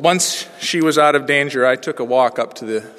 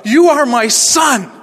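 slow, lifted preaching of the verse, much higher and louder; preached verse; 10 semitones higher, 6 dB louder, slower than usual. Acted. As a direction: lean into each word as good news. Preaching the text.

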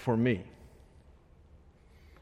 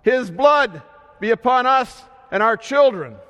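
raised-pitch, mildly shouted declaration; restrained shout; 6 semitones higher, 4 dB louder than usual. Acted. Temple leaders answering for the people, restrained shout. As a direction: call it out higher, short of a full shout. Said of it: "the temple leaders shout".

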